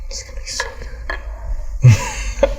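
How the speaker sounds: slow hushed whisper. Real, not acted. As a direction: whisper it slowly so the mic barely catches it. Hushed aside before the poem.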